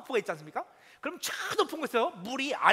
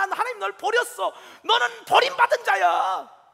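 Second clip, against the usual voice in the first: loud, higher jeering taunts at the believer; jeering shout; 5 semitones higher, 9 dB louder than usual. Acted. 원수들이 시편 기자를 조롱하며 소리치는 장면, loud mocking shout. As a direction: shout the enemies' scorn down at him. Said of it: "그들이 그렇게 말해요".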